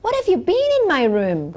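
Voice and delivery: high, displeased scolding voice; a mother catching her child in her room; angry mother. Acted. Mother scolds her child, high and displeased. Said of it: "intonasi yang tinggi yang menunjukkan bahwa tidak suka".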